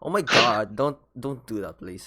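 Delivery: imitated maarte accent, loud start then easing; mock a cousin's maarte American accent; put-on maarte accent for an exclamation. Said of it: "it's like a Maarte accent".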